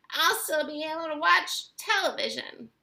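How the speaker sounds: high little squeaky voice; tiny squeak. A narrator's reading. Tiny boy squeaks a defiant reply to his mother. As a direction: squeak back cheekily. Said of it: "squeaked the tiny little voice".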